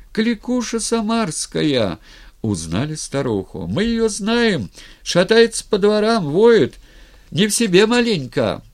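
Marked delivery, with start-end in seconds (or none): 0.1-2.0 high, stretched shout of recognition; crowd calling out. A narrator's reading. Crowd recognizes the old wailer. 3.7-8.6 high, loud calls from the throng; crowd shouting. A narrator's reading. Crowd shouts what it knows.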